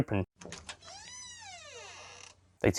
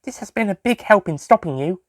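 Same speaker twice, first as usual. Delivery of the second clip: put-on girl voice; 8 semitones higher than usual. A narrator's reading. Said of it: "She held up".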